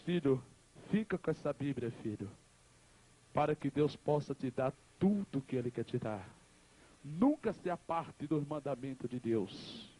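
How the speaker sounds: acted dying mother. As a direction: give last advice calmly, with pauses. Dying mother's last counsel.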